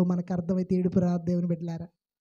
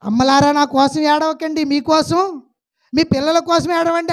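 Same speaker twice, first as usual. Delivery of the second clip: louder, higher, అమ్మలారా called out; loud preaching quote; 7 semitones higher, 8 dB louder than usual. Acted. Preacher proclaiming Jesus' words to the congregation, loud. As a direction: proclaim it to the crowd. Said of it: "అంటే ఎలాగుంది చెప్పండి?".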